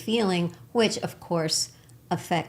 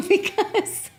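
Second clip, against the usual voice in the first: laughing; 4 dB louder than usual. Real, not acted. Laughing at the obvious.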